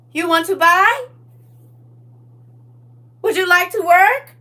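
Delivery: high, loud game character voice; NPC voice. Pretend. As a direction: play a shopkeeper NPC. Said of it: "my version of the N P C".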